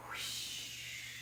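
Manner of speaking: breathy blown whoosh of wind. Acted. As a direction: blow it out like a gust. Wind sound imitated.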